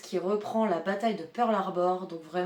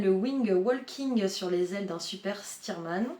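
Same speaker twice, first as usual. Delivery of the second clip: ordinary talk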